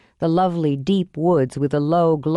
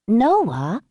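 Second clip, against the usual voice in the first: raised questioning call, rising; calling to wake; 4 semitones higher than usual. Acted. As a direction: call him awake. Mother waking him.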